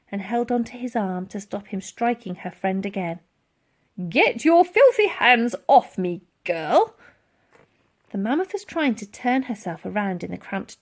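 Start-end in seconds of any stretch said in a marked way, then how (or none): 4.1-7.0 loud slow gruff colonel; angry colonel. Acted. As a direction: growl it as you shake her off. Colonel shaking off Elsie.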